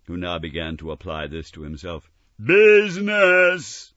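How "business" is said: much higher, much louder, very slow outcry; ghost's bitter wail; anguished cry. Acted. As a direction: fling the word back in torment. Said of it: "cried the ghost".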